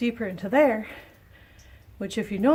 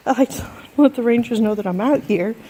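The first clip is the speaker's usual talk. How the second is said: a laugh breaking into the line; louder, laughing through the words; 8 dB louder than usual. Real, not acted. Repeats reason while laughing.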